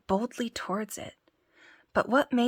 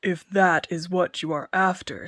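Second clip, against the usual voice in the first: booming giant, louder; lordly giant offering a job; 5 dB louder than usual. Acted. Giant speaks.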